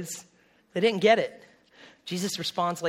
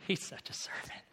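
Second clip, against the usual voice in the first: whispered; 13 dB quieter than usual. Shown as a demonstration. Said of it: "we use servant as".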